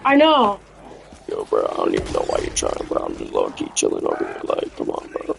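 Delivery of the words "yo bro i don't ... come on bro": very low, laid-back bro voice; deep chill gamer voice. Pretend. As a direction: drop way down, lazy and cool.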